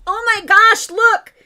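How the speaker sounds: high, loud, delighted outburst; gleeful squeal. Real, not acted. Host spots Bud Light on clearance, gleeful squeal. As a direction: shriek with glee at the bargain.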